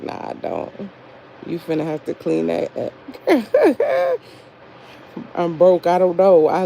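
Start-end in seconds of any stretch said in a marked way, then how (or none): 0.0-0.7 low, soft whisper; whispered backing down. Retold or imitated. Mad, but backing off in a soft whisper. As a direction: swallow the snap back down. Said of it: "Then I'm like".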